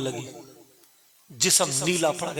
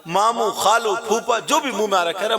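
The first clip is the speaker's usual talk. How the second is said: frantic shouting; 7 semitones higher, 6 dB louder than usual. Acted. Girl calling every relative.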